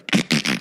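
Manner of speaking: low crackling throat noise; imitated rib crack. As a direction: mimic a bone popping with the throat.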